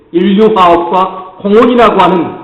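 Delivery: ordinary delivery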